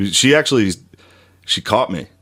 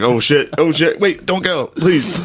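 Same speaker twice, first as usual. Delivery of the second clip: comic panic, higher with each phrase; mock panicked pleading; 6 semitones higher, slower than usual. Owner begging a cat to stay, mock-desperate and high. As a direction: pitch climbing into a desperate plea.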